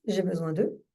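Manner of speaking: low, measured mantra with long pauses; calm guided tapping phrase, unhurried; calming repetition. Shown as a demonstration. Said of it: "Vous voyez que ça calme déjà".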